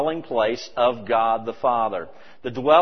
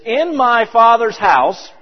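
slow, high and loud on "In my Father's house"; emphatic scripture quote; 10 semitones higher, 10 dB louder, slower than usual. Shown as a demonstration. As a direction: lean hard on "Father's" and stretch it out. Preacher quoting scripture, emphasized.

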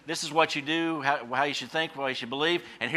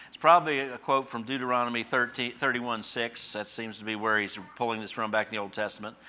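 normal talking voice

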